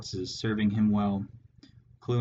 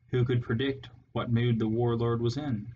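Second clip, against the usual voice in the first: ordinary delivery, nothing marked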